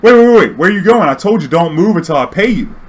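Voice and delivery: loud, high, urgent mock demand; insistent shouting. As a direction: call out urgently to stop him. Insistent passenger stops him.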